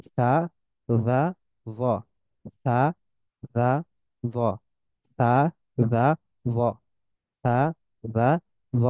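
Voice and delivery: সা, জা, জ each sounded as a distinct Arabic letter; contrasting the three Arabic letters; letter drill. Shown as a demonstration. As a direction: drill সা, জা, জ slowly one by one. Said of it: "এই তিনটার উচ্চারণটা হচ্ছে".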